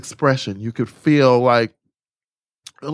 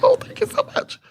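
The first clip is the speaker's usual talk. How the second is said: very high, gushing thank-you. Retold or imitated. Thanking Matthew in a very high flattered squeal. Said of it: "And I was like".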